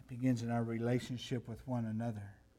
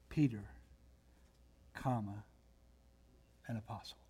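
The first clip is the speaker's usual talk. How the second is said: "Peter," then a long pause, saying "comma" softly; long reverent pauses between "Peter," "comma," "an apostle"; dramatic comma pause; 4 semitones lower, 7 dB quieter, faster than usual. Retold or imitated. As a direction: linger on the pause after "Peter". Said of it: "Gordon did a beautiful job of that little comma".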